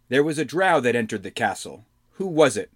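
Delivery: lightly voiced stern questioner; interrogating Lee.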